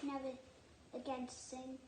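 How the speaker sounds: usual speaking voice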